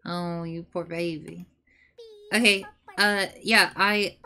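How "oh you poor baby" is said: soft, tender cooing. Real, not acted. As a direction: coo to a pet. Talking to a pet.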